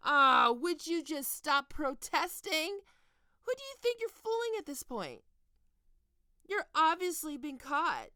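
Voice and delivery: mock his denials as if he's already caught; loud mocking. Mocking his protests, caught him.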